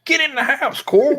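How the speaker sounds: high-pitched mock Southern order; Rick Grimes. Father ordering Carl indoors, exaggerated Southern accent. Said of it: "You look at Rick Grimes".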